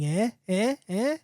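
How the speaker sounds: prodding jeer with a rising last eh. A narrator's reading. Showman to his bar audience, jeering. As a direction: goad the audience for a reaction. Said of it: "jeering at the audience".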